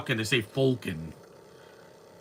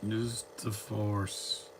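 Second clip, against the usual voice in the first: softer, very slow, hushed quote; hushed Obi-Wan quote; 3 semitones lower, 10 dB quieter, much slower than usual. Retold or imitated. Quoting the film's mentor, hushed and slow. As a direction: breathe it out slowly like an old mentor.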